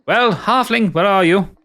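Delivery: high and loud, calling from offstage; woman's voice. Acted. Minerva calls out to the hidden halfling, high and loud. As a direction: call out sharply for the halfling. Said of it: "you hear a woman's voice show".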